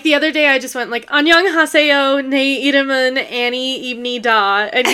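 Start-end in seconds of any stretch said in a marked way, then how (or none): 1.1-4.7 put-on slow, loud, robotic American-accented Korean; deliberately bad American accent. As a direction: plod through Korean in a hammed-up American accent. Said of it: "purposefully, like, really bad American accent".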